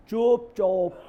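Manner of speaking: lightly mimicked catchphrase, two detached words; put-on Bengali-style "chup chop"; Mamata Banerjee. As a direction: imitate a politician's famous hushing catchphrase. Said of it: "Mamata Banerjee का बहुत मशहूर ये है".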